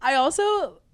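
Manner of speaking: usual speaking voice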